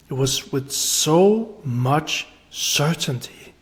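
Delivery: "so" lifted, the rest falling; deliberate, spaced-out insistence on certainty; slow emphatic repeat. Real, not acted. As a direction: spell it out slowly, stressing "so". Repeats the key point slowly.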